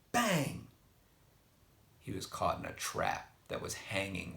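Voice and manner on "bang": bang. A narrator's reading. Trap springs shut.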